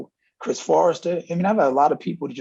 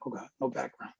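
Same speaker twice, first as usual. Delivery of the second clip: much softer, under the breath; muttered aside; 2 semitones lower, 14 dB quieter than usual. Acted. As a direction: drop it to a private mutter. Inner reaction to recruit's school.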